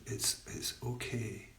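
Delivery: soft, soothing reassurance; self-comforting mantra. Shown as a demonstration. Teacher offers a mantra to the group, softly comforting. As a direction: let it sink softly, fading to very quiet. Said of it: "this self-comforting thing".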